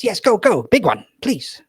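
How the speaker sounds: high, loud, eager wizard voice; old wizard urging the hat on, high and excited. Acted. Wizard peering into his hat, calling out high and eager. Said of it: "he goes".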